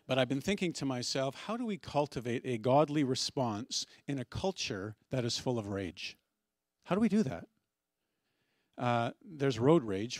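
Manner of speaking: ordinary talk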